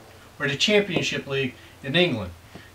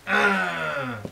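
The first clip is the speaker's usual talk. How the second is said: Frankenstein groan; 3 semitones higher than usual. Retold or imitated. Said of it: "that's more like Frankenstein".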